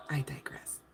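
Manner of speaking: soft whispered self-aside. Real, not acted. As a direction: whisper it to yourself, amused. Aside after laughing.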